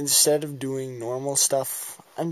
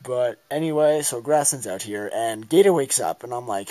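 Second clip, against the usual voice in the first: normal talking voice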